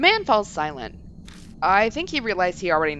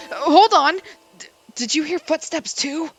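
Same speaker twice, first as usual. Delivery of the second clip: startled; 8 semitones higher, 5 dB louder than usual. Acted. Startled by footsteps.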